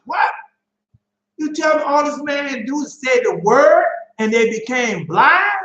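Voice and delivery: rising, astonished preacher's cry; incredulous shout. Real, not acted. Preacher to the congregation, amazed shout. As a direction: shout it out in disbelief at the miracle.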